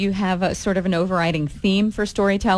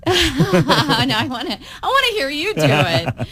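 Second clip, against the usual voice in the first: laughing insistence; 8 semitones higher, 3 dB louder than usual. Real, not acted. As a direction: tease him through laughter, pitched high. Interviewer laughs while teasing the singer.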